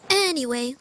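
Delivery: usual speaking voice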